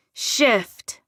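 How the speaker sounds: a touch of a growl on the order; one forceful, growled word; growled command. A narrator's reading. Irritated woman orders attacking wolves to shift, growling. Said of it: "I practically growled".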